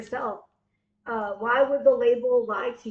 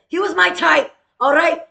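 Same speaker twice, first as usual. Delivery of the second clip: higher, louder insistence; emphatic insistence; 6 semitones higher, 8 dB louder than usual. Real, not acted. Insisting to the viewers the guy was his type, emphatic.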